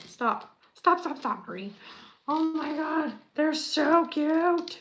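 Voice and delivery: high and loud, stretched out on so cute; gushing squeal. Real, not acted. Builder gushes to Marie over tiny candy pieces. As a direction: gush over something adorable.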